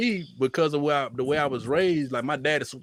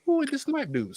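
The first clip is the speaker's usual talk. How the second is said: high-pitched baffled stranger; puzzled page visitor; 14 semitones higher than usual. Acted. Passer-by clicking on Snipe's page, high puzzled voice. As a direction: ask it high, as someone who has no clue. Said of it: "and be like".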